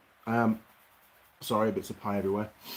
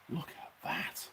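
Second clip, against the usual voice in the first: whisper; 13 dB quieter than usual. Real, not acted. Showing off the pie.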